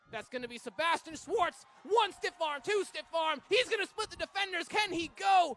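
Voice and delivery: loud, high-pitched breakaway call; excited play-by-play. Real, not acted. Commentator calling a runner splitting the defenders, excited. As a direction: build the thrill as the runner breaks clear.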